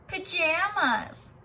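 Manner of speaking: slow, high sing-song "Pajamas!"; teasing. Acted. Children teasing the new girl, mocking sing-song. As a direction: draw out "Pajamas!" like a playground taunt. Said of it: "they teased".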